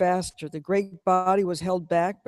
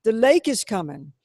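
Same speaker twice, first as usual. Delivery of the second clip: crying out; 4 semitones higher, 7 dB louder than usual. A narrator's reading. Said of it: "crying the same as they went".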